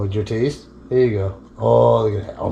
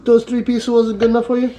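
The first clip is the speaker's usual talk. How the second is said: high teasing pet voice; talking to a dog; 9 semitones higher than usual. Real, not acted. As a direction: playfully scold the beggar.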